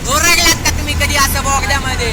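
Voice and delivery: loud, high calls to hear the echo; shouting in the tunnel. Real, not acted. Vlogger shouting to viewers inside a tunnel, loud and high. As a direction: yell it out so it booms off the tunnel walls.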